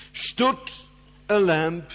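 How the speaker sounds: "Stood" high and loud, then a pause; stress on STOOD. Shown as a demonstration. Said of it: "emphasis is always on the first word".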